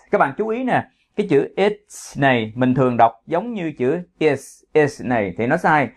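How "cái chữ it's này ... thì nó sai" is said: normal talking voice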